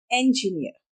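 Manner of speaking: इंजीनियर opening with e, as "enjiniyar"; "e" sound first. Shown as a demonstration. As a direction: start इंजीनियर on e as in egg. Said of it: "इंजीनियर नहीं बोलते". (इंजीनियर की शुरुआत 'ए' से)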